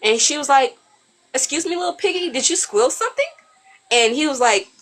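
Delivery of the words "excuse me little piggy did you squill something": high, sing-song challenge; mock taunting. Armed woman to the man's friend, mock taunting. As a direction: needle him like a little piggy.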